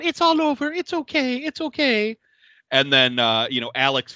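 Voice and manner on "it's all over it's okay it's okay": high, hushed reassurance of a killer; soothing psycho Marie. Retold or imitated. Marie petting bruised Alex, high eerie soothing. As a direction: soothe her like a pet, eerily. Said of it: "She's going".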